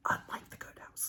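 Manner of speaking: breathy whispered jab at another book; whispered aside. Real, not acted. Whispering a sly aside to viewers about a book. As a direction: drop to a whisper for the dig.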